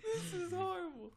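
high, slow, tearful laughing wail; real laughing till it hurts; crying with laughter. Real, not acted. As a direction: groan it helplessly through laughter. Overcome with laughter.

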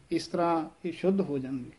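usual speaking voice

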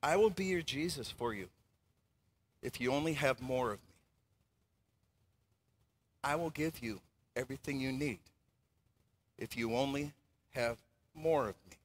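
soft, low seductive whisper; low tempting voice of money, very soft; money's whisper. Acted. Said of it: "it whispers".

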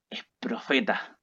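near-whispered retold disclosure; hushed revelation. Señora confiding about her daughter, hushed. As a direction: drop to a slow, secretive whisper.

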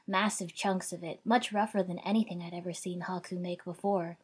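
ordinary delivery, nothing marked

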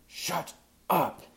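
high, sharp snapped rebuke; sharply spat. A narrator's reading. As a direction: spit it out angrily. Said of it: "he sharply spat into his shoulder pad".

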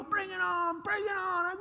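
imitating a cocky big brother; goading taunt. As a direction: egg the little brother on. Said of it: "he'd go".

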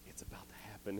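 soft passed-along whisper. Retold or imitated. Soldiers pass the word around the camp, whispering. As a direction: whisper it down the line. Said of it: "he hears whispering coming around".

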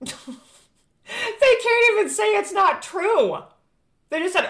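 scornful laughing disbelief; high, slow laugh through the words. Real, not acted. Mocking laugh at the denial.

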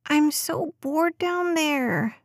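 put-on high, slow, whiny little girl's groan; groaning bored child. A narrator's reading. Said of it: "Des groaned".

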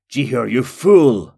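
loud, drawn-out furious shout; angry yell. A narrator's reading. Nalur Blackcloak berates Jihur, angry yell. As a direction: yell it slowly in outrage. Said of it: "Nalur Blackcloak yelled".

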